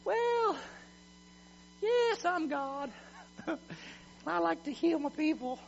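hammed-up wishy-washy high voice; timid, unsure God. Preacher parodying a God sitting back, in a meek high voice. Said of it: "Do you think He's sitting back saying".

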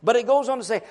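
ordinary talk

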